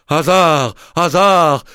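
déclamé aigu et fort, chute; hammy stage actor. Acted. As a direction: proclame comme un tragédien. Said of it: "en déclamant". (déclamation grandiloquente, aiguë)

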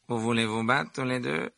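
mildly contemptuous question; a touch of disdain; scornful. A narrator's reading. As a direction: scoff at their challenge. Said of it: "Lança Malfoy avec mépris".

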